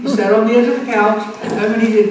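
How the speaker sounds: usual speaking voice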